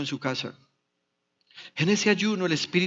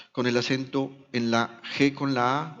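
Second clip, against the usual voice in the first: ordinary delivery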